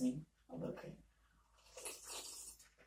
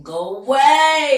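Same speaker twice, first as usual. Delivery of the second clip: loud, high scare voice; scary voice; 10 semitones higher, 15 dB louder, slower than usual. Acted. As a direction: shout to scare it off. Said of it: "Scare voice".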